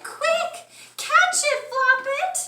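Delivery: very high, loud cry; Chicken shouting. A narrator's reading. Hen shouts at the bunny to catch the falling chick. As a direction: shriek for him to hurry. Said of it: "Chicken shouted".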